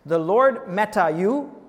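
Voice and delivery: "meta you" slow and loud, each word weighted; "The Lord meta you" drawn out with force; emphatic quote. Shown as a demonstration. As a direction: land hard and slowly on "The Lord meta you". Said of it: "two times this emphasis".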